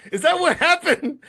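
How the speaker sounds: laughing. Retold or imitated. Mocking a caller's threat.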